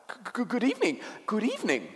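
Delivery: high, stammering faun greeting; nervous creature's repeated greeting; flustered faun. A narrator's reading. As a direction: stutter out a flustered, high-pitched hello. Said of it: "said the faun".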